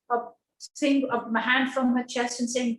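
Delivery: normal talking voice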